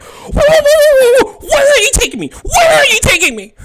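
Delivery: high, loud alarmed wail; panicked screaming. Acted. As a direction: scream in alarm as you're being carried off. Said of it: "he starts screaming".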